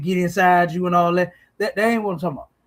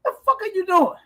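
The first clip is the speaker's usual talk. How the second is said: incredulous outburst; 11 semitones higher, faster than usual. Real, not acted. Incredulous reaction to church practice.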